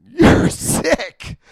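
loud, high, laughing you're sick; laughing disbelief. Retold or imitated. Reacting to sponsor's own story.

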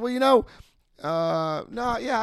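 high, fumbling hedge; nervous stalling. Retold or imitated. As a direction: stall with a squeaky uh. Stalling answer to sponsor.

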